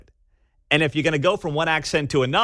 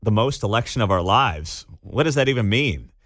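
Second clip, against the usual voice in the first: ordinary delivery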